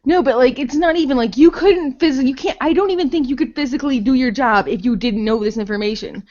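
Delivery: normal talking voice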